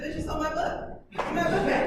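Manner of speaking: loud, high mock outrage with laughter; mad. Teacher quotes the mad character to the class, loud mock anger. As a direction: act out an angry outburst, half laughing. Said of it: "so Isle's mad. She said".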